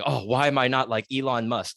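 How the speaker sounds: usual speaking voice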